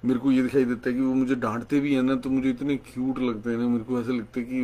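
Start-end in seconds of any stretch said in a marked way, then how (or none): none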